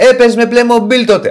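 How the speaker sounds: ironic. Retold or imitated. Co-host quoting the dig made at him, ironic. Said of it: "μου λέει ειρωνικά".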